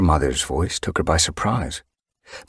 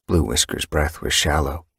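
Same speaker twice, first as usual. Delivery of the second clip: plain reading voice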